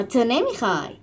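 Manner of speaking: usual speaking voice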